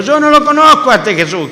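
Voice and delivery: heated high-pitched refusal; indignant denial. Acted. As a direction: Peter insisting he doesn't know him. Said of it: "acá está diciendo".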